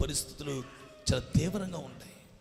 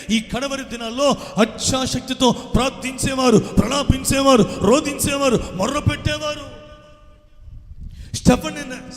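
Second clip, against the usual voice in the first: higher, louder preaching that builds to a shout; fervent preaching; 6 semitones higher, 6 dB louder than usual. Acted. Preacher rousing the congregation, building fervent preaching. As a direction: drive the sermon up to a cry.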